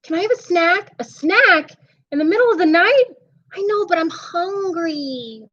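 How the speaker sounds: put-on pleading little boy at bedtime, voice up high; a kid bargaining to stay awake; high child voice.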